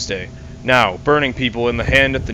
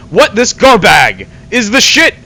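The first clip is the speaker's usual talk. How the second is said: loud, higher, disgusted yelp of a different voice; disgusted outburst; 6 semitones higher, 8 dB louder than usual. Acted. Queen finds garbage in the salad, loud disgusted outburst. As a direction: spit it out in outrage at the garbage. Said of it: "and said".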